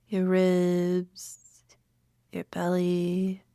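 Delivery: unhurried meditation guide with long pauses; calm, spacious meditation voice; guided meditation. Acted. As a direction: lead listeners gently inward, leaving room to breathe.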